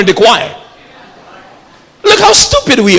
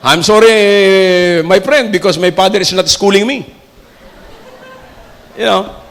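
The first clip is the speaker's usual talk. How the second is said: put-on drunk man's English; 4 semitones lower than usual. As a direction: play the tipsy man showing off his English. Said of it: "ma-intoxicate siya ng alcohol".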